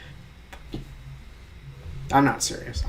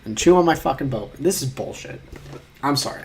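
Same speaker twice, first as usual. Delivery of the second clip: ordinary talk, nothing marked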